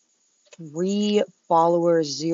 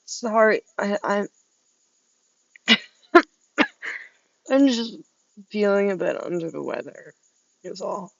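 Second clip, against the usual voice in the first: fake sick voice; 3 semitones higher, 3 dB louder than usual. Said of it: "this raspy voice".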